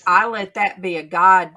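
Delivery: ordinary talk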